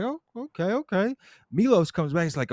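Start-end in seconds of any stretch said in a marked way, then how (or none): 0.0-1.1 put-on much higher, a bit softer, eyeing the girl; mild mock distraction; distracted okay. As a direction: trail off, caught by a pretty face.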